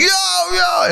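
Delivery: high-pitched shout of "Yo"; calling out Yo. Retold or imitated. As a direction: call out like kids in the hallway. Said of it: "So everybody like".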